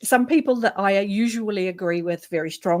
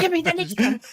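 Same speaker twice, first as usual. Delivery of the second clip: eager demand; 10 semitones higher than usual. Retold or imitated. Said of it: "And then I was like".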